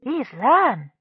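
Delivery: mild pride. A narrator's reading. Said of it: "Smallspot said proudly".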